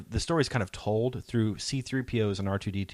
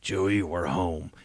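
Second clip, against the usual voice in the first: lower, slow, laid-back Han Solo line; Han Solo impression; 3 semitones lower, slower than usual. Fan imitating Han boarding the Falcon, lower and slow. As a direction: drawl it like a weary smuggler coming home. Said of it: "walks on the Millennium Falcon and says".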